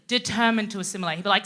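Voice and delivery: ordinary delivery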